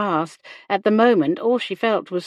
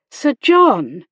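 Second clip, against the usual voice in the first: higher, louder shocked protest; 4 semitones higher, 8 dB louder than usual. A narrator's reading. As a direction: object sharply to his name. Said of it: "Hannah protested".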